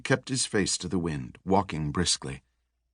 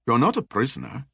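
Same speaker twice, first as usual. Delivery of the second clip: put-on French accent; 4 semitones higher than usual. A narrator's reading. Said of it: "with a distinct French accent".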